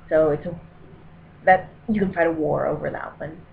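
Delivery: ordinary talk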